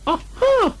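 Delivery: very high thin squeak of an aha; trying to sound knowing; feeble aha. Acted. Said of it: "said Piglet again".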